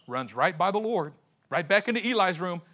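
normal talking voice

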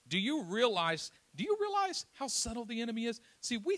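rising, forceful preacher's plea; preaching. Acted. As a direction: press the congregation with the question.

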